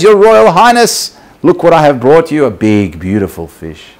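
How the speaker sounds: high, loud, grand address; proud presentation. Acted. Fisherman bowing before the king with a gift, proudly proclaiming. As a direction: announce the gift grandly, voice lifted.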